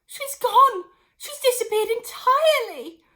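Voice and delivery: high, loud cry; cried out. Acted. Missus Cranky crying out that her mother vanished. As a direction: cry out in shock. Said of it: "cried Missus Cranky".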